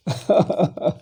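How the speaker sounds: brief chuckle; laughing. Real, not acted. Lecturer jokes about opponents' self goals, chuckling. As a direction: chuckle at your own joke.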